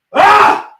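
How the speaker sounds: a sharp high yelp, out of nowhere; sudden yelp. Real, not acted. Fan watching the match yelps in shock, high cry. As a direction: let out a startled high cry.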